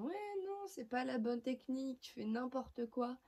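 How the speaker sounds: high, whiny nitpicking voice; mock critics. Critics attack her cream technique, high mocking voice. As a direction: mimic fault-finders, starting very high. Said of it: "Il y en a qui diront".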